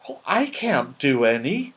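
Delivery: plain reading voice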